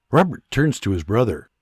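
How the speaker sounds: usual speaking voice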